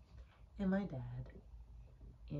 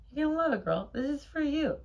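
high, loud pep talk; eager, high-pitched persuasion; excited coaxing; 9 semitones higher, 7 dB louder, much faster than usual. Real, not acted. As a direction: hype it up to win over a restless pet. Hyping the story to Kiki.

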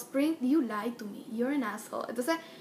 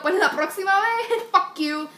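higher and louder, ending in a laugh; defiant comeback; 6 semitones higher, 6 dB louder than usual. Retold or imitated. Daughter telling her father off, defiant then laughing. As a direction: fling it back with a laughing sneer. Her retort in retold argument.

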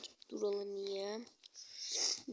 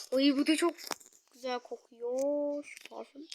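ordinary talk, nothing marked

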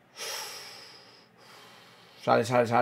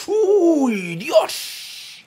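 surprised cry; 12 semitones higher than usual. Real, not acted. Close chance in the game.

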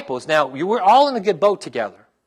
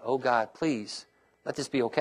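a quiet plea to God, held back; hushed inner prayer; 5 semitones lower, 10 dB quieter than usual. Retold or imitated. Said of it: "I'm going".

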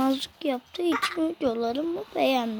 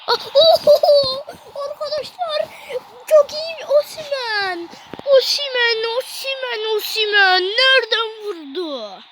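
much higher and louder, laughing and crying out; goal celebration; 7 semitones higher, 5 dB louder than usual. Real, not acted. Gamer shrieking as his striker scores, ecstatic. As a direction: celebrate a great goal with a squeal.

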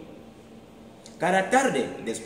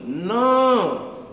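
high, loud cry of no; group shout of alarm; everyone shouting; 11 semitones higher, 8 dB louder than usual. Acted. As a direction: yell it all together. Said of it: "gritaron todos".

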